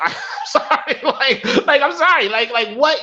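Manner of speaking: laughing through an apology, pitch jumping up. Real, not acted. Laughing to viewers while apologizing. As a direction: giggle out the sorry.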